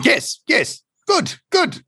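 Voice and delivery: very high, pleased creature chirp; bird doctor. Acted. Bird doctor approves Tortle's answer, very high voice.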